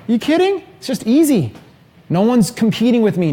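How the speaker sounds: high-pitched, breezy brush-off in a retold quote; mock incredulity. German marketer to questioner on having no competition, mock incredulous. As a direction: quote him scoffing, pitched well up.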